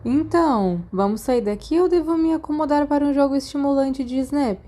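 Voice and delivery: put-on a cheeky boy ribbing his friend in the sickbay; Sirius, teasing. Sirius jokes to Remus about leaving, lightly teasing.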